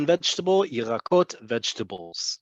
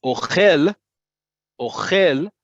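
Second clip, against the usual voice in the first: "Ochel" stressed on the last syllable, o-KHEL, higher and louder; 3 semitones higher, 6 dB louder, slower than usual. Shown as a demonstration. Teacher shows the verb eats, strong stress on KHEL in "Ochel". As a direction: drive the weight onto khel in "Ochel". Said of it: "accent on the last syllable".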